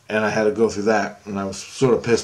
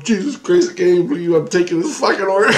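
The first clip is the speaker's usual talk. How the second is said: louder, higher, amused exclamation; quick, high, half-laughed quote; retold laughing disbelief; 8 semitones higher, 4 dB louder, faster than usual. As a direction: imitate someone cracking up in disbelief. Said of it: "And he's laughing, he's going".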